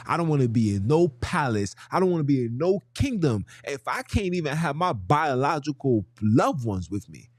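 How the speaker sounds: usual speaking voice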